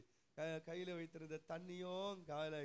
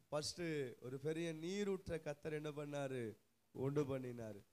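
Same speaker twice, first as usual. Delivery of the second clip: ordinary delivery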